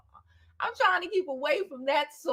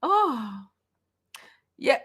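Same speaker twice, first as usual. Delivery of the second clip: usual speaking voice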